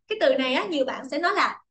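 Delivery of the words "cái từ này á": normal talking voice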